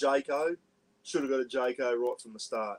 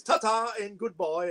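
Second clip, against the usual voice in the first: high, airy posh English farewell; mock-posh Englishman, pitched well up; posh Pommy accent; 9 semitones higher than usual. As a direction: bid a theatrical posh English goodbye. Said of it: "putting on this pom-, posh Pommy accent".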